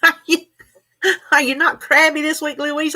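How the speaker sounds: high, loud playful ribbing; playful teasing. Real, not acted. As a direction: tease her cheerfully. Teasing a live chat viewer.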